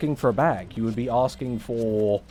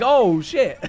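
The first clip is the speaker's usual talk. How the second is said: higher, louder oh shit; mock dismay; 9 semitones higher, 6 dB louder than usual. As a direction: play the sudden realization. Mock reaction quote.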